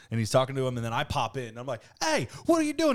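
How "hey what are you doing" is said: high, playful retold call-out; excited hail. Host retells butting into a friend's phone call, excited hail. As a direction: pop in with a high, eager holler.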